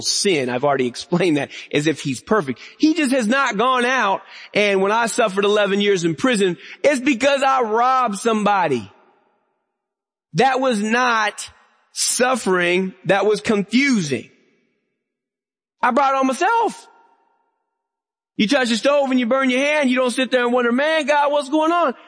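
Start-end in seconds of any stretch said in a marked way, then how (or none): 2.8-20.7 high, ringing pulpit voice; preaching at full force. Acted. 20.7-21.9 exasperated shout. Acted. Acted cry of a confused sufferer.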